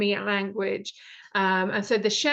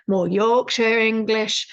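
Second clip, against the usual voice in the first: louder, clumsy attempt at a Yorkshire accent; bad Yorkshire accent; 6 dB louder, slower than usual. Shown as a demonstration. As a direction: badly fake a Yorkshire accent. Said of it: "that was really bad Yorkshire accent".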